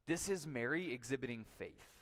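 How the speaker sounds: ordinary talk, nothing marked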